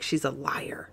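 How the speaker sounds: soft, whispered retort; imitated muttered accusation. Host quoting him doubting Courtney, whispered accusation. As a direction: drop to a mock accusing whisper.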